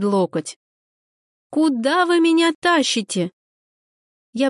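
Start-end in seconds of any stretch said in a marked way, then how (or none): 1.5-3.3 alarmed protest. A narrator's reading. Dragged off, protesting.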